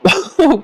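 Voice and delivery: a short, high, bashful giggle; coy little laugh, acting embarrassed; shy giggle. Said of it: "थोडंसं लाजल्यासारखं करते".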